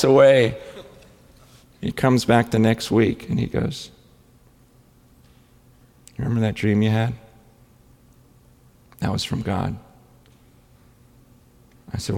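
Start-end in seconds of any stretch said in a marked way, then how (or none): 6.2-9.8 low, soft and deliberate; grave, hushed revelation. Retold or imitated. Staff member confiding in his pastor about a dream, gravely quiet. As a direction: let it land quietly after a long pause.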